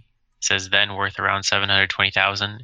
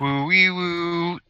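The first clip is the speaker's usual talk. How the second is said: high, slow ghostly woo; spooky woo sound; 9 semitones higher, much slower than usual. Acted. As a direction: make a mock spooky conspiracy noise. Mocking conspiracy talk.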